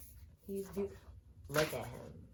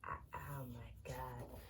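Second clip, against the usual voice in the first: breathless slow whisper; 4 semitones lower, 7 dB quieter than usual. Real, not acted. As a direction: barely breathe the words, overwhelmed. Overwhelmed fan, breath only.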